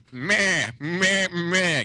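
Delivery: high, loud bleating "meh"; mock sheep bleat. Co-host teasing about crossbreeding, mock sheep bleat. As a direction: bleat like a sheep in short bursts.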